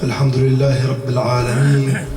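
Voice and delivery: fast, chanted opening prayer formula; rapid Arabic invocation. Acted.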